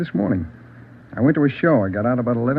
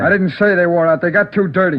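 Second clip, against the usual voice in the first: angry; 6 semitones higher, 4 dB louder than usual. Acted. Suspect cornered, raising his voice.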